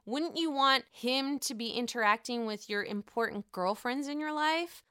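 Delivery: usual speaking voice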